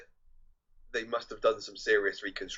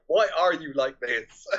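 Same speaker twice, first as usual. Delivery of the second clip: slow, loud, raised-pitch complaint; mock exasperated; 5 semitones higher, 9 dB louder, slower than usual. Kids asking their parents, loud mock exasperation. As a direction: draw it out loudly, close to laughing.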